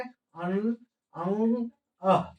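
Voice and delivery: अन, अंग, अह separately, ending in n, light g, h; three contrasted endings. Shown as a demonstration. Teacher modelling three sounds, अन, अंग, अह distinctly. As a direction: set apart अन, अंग, अह by their endings. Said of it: "इसको क्या बोलेंगे".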